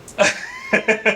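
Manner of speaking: short high laugh breaking out at his own joke; a bright laugh at the silly cake joke; burst of high laughter. Real, not acted. Laughing at his own joke about the cake.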